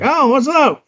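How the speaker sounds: squeaky high goblin greeting. Retold or imitated. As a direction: pitch way up like a tiny goblin. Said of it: "And he's like".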